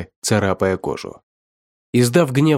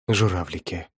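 low, soft stunned murmur; hushed, taken aback; stunned exhale; 4 semitones lower, 6 dB quieter than usual. A narrator's reading. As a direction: breathe the word out, dumbfounded. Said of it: "ошарашенно выдохнул он".

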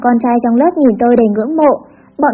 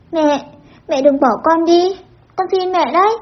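put-on soft, rising child's plea; tearful sobbing plea; 5 semitones higher, 3 dB quieter, slower than usual. A narrator's reading. Child clinging to mother, begging her to stay, tearful plea. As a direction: plead softly, voice climbing high at the end. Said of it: "khóc lóc nức nở".

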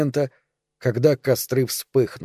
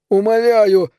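high strained wheeze, louder; a gasping, strangled cry for mercy; choking plea; 8 semitones higher, 5 dB louder, slower than usual. A narrator's reading. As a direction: beg while choking on the noose. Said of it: "захрипел он, задыхаясь".

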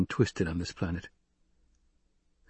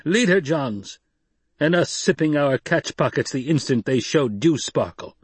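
much higher, louder outraged voice; angry protest; 7 semitones higher, 3 dB louder than usual. Acted. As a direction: rail against the boy, high and loud. Jameis angrily protesting.

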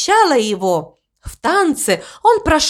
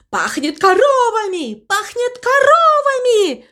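distant echoing cry; 12 semitones higher than usual. A narrator's reading. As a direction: call it like an echo from the forest. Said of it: "ответило эхо из вороньего леса".

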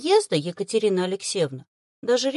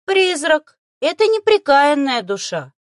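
high, loud lecturing creature; didactic; 6 semitones higher, 7 dB louder than usual. A narrator's reading. Little beast explains what a ghost is, preachy and didactic. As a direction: instruct her firmly, pitched high. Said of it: "наставительно продолжал он".

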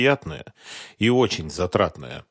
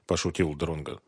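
plain reading voice

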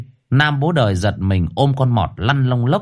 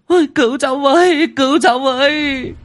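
panicked woman. A narrator's reading. Fleeing woman begs the old man for help, in a high frantic shriek. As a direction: scream for rescue while running.

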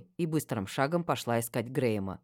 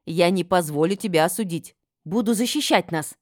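loud, raised-pitch determined vow; resolute; 4 semitones higher, 6 dB louder than usual. A narrator's reading. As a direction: promise protection firmly and forcefully. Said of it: "решительно ответила я". (решительно, громко, с напором)

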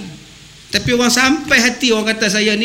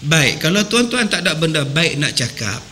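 normal talking voice

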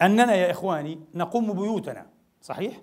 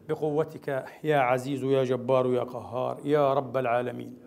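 lower, steady supplication; closing prayer; 4 semitones lower, 4 dB quieter than usual. Real, not acted. Preacher leading the congregation in closing prayer, lower and steady. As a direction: pray it humbly and evenly.

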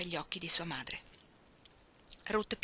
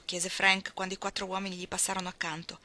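ordinary delivery, nothing marked

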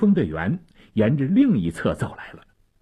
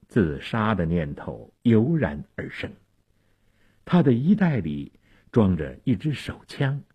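plain reading voice

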